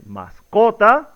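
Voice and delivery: "mascota" stressed on CO, masCOta, rising; questioning "mascota" with the middle syllable accented. Shown as a demonstration. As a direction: lean on CO in "mascota", as an option. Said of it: "la pronunciación correcta es".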